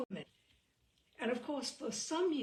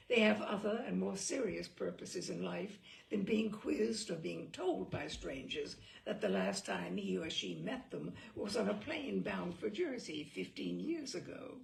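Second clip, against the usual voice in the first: usual speaking voice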